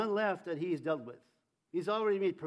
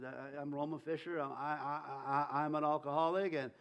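timid; 5 semitones lower than usual. Retold or imitated. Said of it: "I didn't want anybody to see or hear me".

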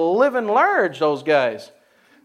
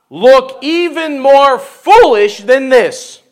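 slow emphatic reading; 7 dB louder, much slower than usual. Acted. David's vow, slowed.

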